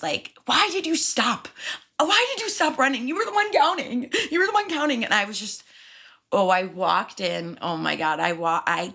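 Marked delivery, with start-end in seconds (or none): 0.5-5.0 high-pitched, exasperated retold protest; mad. To the coworker who stopped running, mad. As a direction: replay the outrage, pitched way up. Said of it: "I was so mad".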